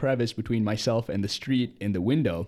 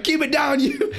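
high, louder mock yell; mock shout; 6 dB louder than usual. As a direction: yell it at the ducks, hammed up. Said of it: "I swear I wanna go".

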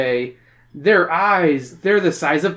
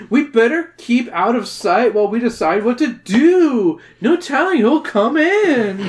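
put-on valley girl voice; 7 semitones higher, 3 dB louder than usual.

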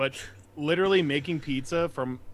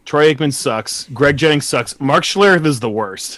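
loud rant; 12 dB louder than usual. Real, not acted. Ranting about commentators.

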